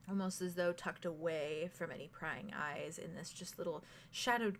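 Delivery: softly paced GM narration; game master narration. A narrator's reading. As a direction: draw the players into the vision. GM scene narration.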